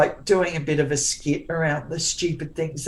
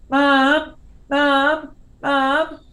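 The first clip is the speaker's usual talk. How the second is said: put-on high, loud kids calling out mum; mock kids calling; 10 semitones higher, 5 dB louder, much slower than usual. Mother imitating her boys calling her, high and loud. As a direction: call out like kids roaming the house.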